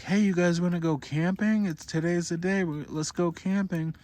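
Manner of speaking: high, bright upbeat call; cheery invitation. Retold or imitated. Parents waking the kids, high and cheerful about camping. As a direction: sell the camping trip cheerfully.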